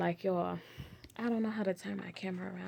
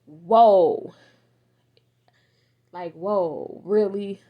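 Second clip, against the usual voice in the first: surprised whoa; 12 dB louder, much slower than usual. Retold or imitated. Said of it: "looking at myself like".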